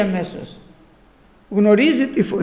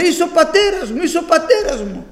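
higher, a little louder joyful calling out; retold joyful shouting; 8 semitones higher, 4 dB louder than usual. Preacher reliving his childhood cry, calling out a little louder. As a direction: cry it out like the boy who was just reborn. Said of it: "Θυμάμαι, φώναζα".

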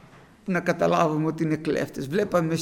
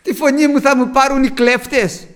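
put-on frightened child; 5 semitones higher, 7 dB louder than usual. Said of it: "με πιάσε ακόμα πιο μεγάλος φόβος".

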